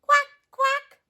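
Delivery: high, loud duckling quacks; little duck quack. Acted. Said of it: "And Little Duck says".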